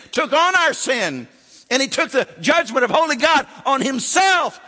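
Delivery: fiery revival preacher, voice ringing out; preaching at full force. Acted. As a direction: proclaim it to the whole church. Preaching climax on the cross.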